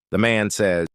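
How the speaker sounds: usual speaking voice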